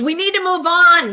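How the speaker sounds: high, loud mock yell; mock scream. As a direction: imitate a host screaming for order. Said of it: "Andy screams".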